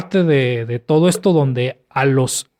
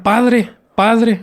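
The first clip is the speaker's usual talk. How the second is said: retold cry, raised higher; imitated child's cry; 7 semitones higher than usual. Boy calling to his father, mild mock cry. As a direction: call out like a child, without full shouting. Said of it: "el niño empieza a gritar".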